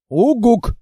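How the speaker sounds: loud falling hoot; firm hoot. Acted. Monster hoots firm agreement to the girl.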